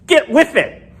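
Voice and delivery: sharp, high scold; a burst of mock irritation at the drivers; upset scolding. Real, not acted. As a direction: snap at the crowd in mock anger. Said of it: "Sorry, I get upset".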